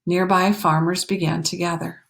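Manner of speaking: usual speaking voice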